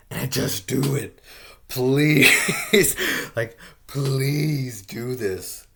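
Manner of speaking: exasperated loud pleading with Congress; desperate shouted begging. Real, not acted. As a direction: beg at the top of your voice.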